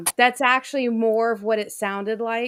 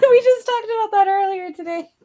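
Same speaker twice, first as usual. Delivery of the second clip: high, laughing through words; 10 semitones higher, 5 dB louder than usual. Real, not acted. Laughs while speaking.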